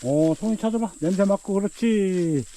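high, eager dog-training voice; talking to a dog. Real, not acted. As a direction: encourage the dog to sniff. Training dog to find mushrooms.

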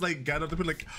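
usual speaking voice

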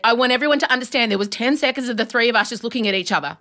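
ordinary talk

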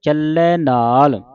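slow ਚੱਲੈ with open ai; double-lavan ending. Shown as a demonstration. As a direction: draw out ਚੱਲੈ, then long ਨਾਲ. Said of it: "ਦਲਾਵਾਂ ਦੇ ਨਾਲ ਪੜ੍ਹਨਾ". (ਚੱਲੈ ਦਲਾਵਾਂ ਨਾਲ)

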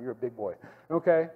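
ordinary talk

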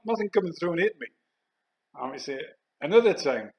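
ordinary delivery, nothing marked

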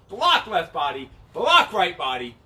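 slow, deliberate move calls; slow walkthrough. Shown as a demonstration. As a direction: spell each move out slowly. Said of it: "walk through that a few times nice and slow".

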